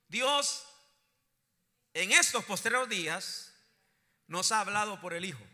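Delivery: loud scripture proclamation, phrase by phrase; congregation call-and-repeat. Real, not acted. Preacher resumes the verse for the congregation, proclaiming loudly.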